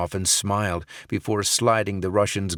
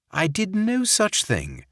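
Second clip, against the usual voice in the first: much higher, slow fake English accent; fake English accent, denial; 12 semitones higher than usual. Barrett denying the deed to Sokolov in fake English accent. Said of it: "in his fake English accent".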